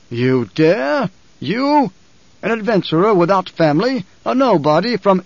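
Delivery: high, incredulous imperial indignation; outraged emperor. Acted. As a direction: scoff in rising outrage at an upstart.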